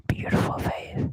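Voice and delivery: hushed whisper to the camera. Acted. Gamer whispering to viewers about own face, whisper. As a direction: breathe it out admiringly.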